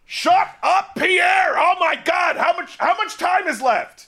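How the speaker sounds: high, loud furious yell; angry shout. Acted. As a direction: explode at him, yelling. Reporter snaps at co-correspondent.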